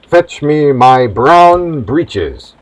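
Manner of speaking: very slow, deliberate command; captain's punchline order. Acted. Captain ordering the cabin boy, slow and grave. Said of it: "Cabin boy, cabin boy".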